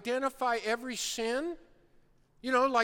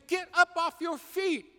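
raised, pleading call to get up; the Lord urging; 6 semitones higher, 4 dB louder than usual. Retold or imitated. The Lord calling a sinner off the bench, urging. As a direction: urge him back into the game. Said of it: "He's saying".

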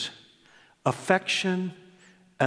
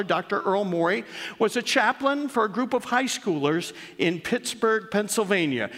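reading aloud; 7 semitones higher than usual. Acted. Pastor reading his daughter's tribute to the church, raised storytelling read. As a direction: read it like a storyteller opening a tale.

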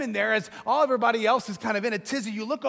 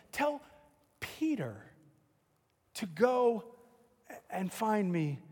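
tender, hushed; 5 semitones lower, 10 dB quieter than usual. Retold or imitated. Jesus's message to Peter.

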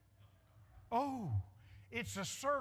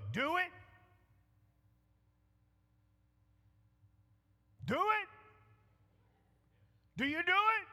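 higher and louder each time, pressing; building urging; 7 semitones higher, 6 dB louder than usual. Real, not acted. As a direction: push them harder with every repeat. Preacher urging congregation to act.

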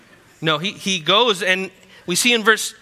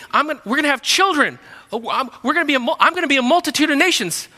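mock excited; 9 semitones higher, 5 dB louder, faster than usual. Sarah mocking God's promise.

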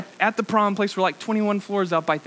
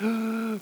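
mock gasp; 6 semitones higher, 3 dB quieter than usual. Finding the bathroom out of order.

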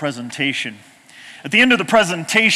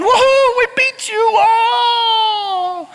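much higher, loud, slow stretched-out whoop; cheering on the bank after winning; triumphant cheer; 4 dB louder, much slower than usual. Retold or imitated. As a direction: crow over winning the race. Victory cheer on the riverbank.

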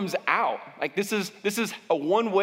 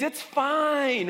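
passive-aggressive denial; 6 semitones higher than usual. Acted. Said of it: "Passive-aggressive people get really, really sneaky".

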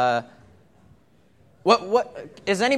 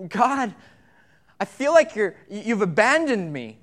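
anguished plea; 4 semitones higher, 5 dB louder than usual. Acted. Voicing David's despair.

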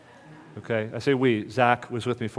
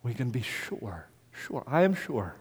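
whispered certainty; 5 dB quieter, slower than usual. Acted.

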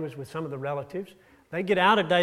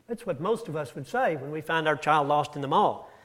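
usual speaking voice

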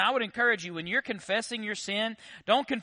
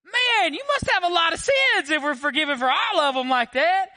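high, loud comic outburst; mock astonished shout; 11 semitones higher, 8 dB louder than usual. Preacher teasing deacons about vague confession, mock astonished shout. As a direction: holler it in mock disbelief, pitched way up.

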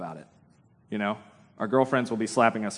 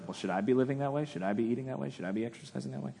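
softer, trailing away as they pile up; self-doubting inner questions; 9 dB quieter, faster than usual. Acted. Listener privately questioning their own lifestyle, softly self-doubting. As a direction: ask yourself quietly, getting softer and quicker.

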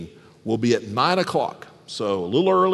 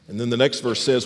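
ordinary delivery

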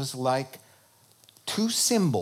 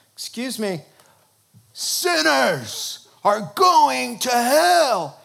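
preacher's call, building to a slow, louder proclamation on hell; street preacher; 7 semitones higher, 7 dB louder, slower than usual. Retold or imitated. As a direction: stretch out the warning like a street preacher. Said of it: "There's a street preacher".

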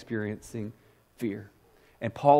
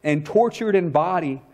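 quoting Spurgeon; 5 semitones higher than usual. A narrator's reading. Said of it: "And Spurgeon wrote".

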